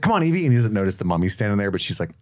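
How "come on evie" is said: high call across a room. Retold or imitated. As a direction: call to her urgently. Rick calling out.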